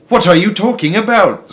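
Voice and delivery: high, loud baffled question; bewildered. A narrator's reading. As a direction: sound completely baffled. Mrs Bucket bewildered.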